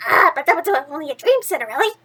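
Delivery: a small mouse gently warning his friend; little mouse warning. Acted. Mouse Jack warns Cinderella.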